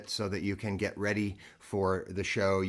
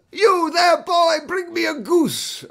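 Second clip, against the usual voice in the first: high, very loud theatrical holler; hammed-up Dickens character; 11 dB louder, slower than usual. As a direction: shout it slowly down to the street like Scrooge on Christmas morning. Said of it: "That was my Dickens".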